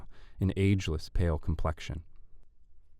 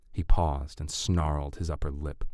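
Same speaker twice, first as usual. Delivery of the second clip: ordinary delivery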